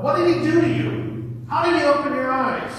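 high, loud questioning; demanding interrogation. Acted. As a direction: press him hard for answers. Pharisees interrogate the man.

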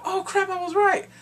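high, loud told-you-so; excited realization. Retold or imitated. Excited own reaction.

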